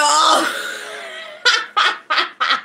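long, high cackle; helpless laughter. Real, not acted. Streamer cracking up at her live chat, helpless high laughter. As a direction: burst out laughing and keep going.

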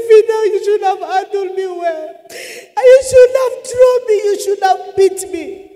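high, loud crying reproach; crying plea. Retold or imitated. Crying to her sister for not handling her well, tearful wail. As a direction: wail it at your sister through tears. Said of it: "I started crying with my sister".

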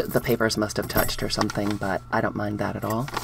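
normal talking voice

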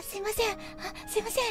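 a hint of a shy junior, hushed; timid newcomer. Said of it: "みたいな感じで".

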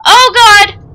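ordinary talk, nothing marked

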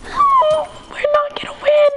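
loud, squeaky mock despair; high-pitched wail. Losing the match.

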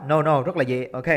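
ordinary delivery

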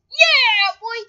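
high, very loud whoop; excited shout. Real, not acted. Gamer cheering on the game, excited shout. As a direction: belt it out like a cheer.

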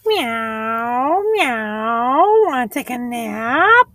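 very high, sliding kitty voice; high-pitched cat with drawn-out, swooping meows; meowing cat. Acted. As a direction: mew the words like a cat. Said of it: "asked the cat".

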